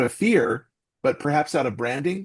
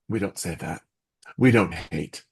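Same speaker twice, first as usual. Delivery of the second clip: a lightly voiced sweet mom, lower and softer; sweet motherly scolding; 7 semitones lower, 3 dB quieter than usual. Comedian imitating his mother correcting him, sweet and motherly. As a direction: gently correct the child like a doting mother. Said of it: "it was so motherly and so sweet and cute".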